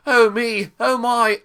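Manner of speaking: high mock "oh me, oh my"; Toby impression. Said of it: "when Toby was, uh, looking around and saying".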